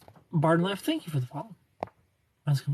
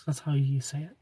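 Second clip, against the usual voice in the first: whisper; 5 dB quieter than usual. Real, not acted. Whispered aside to viewer.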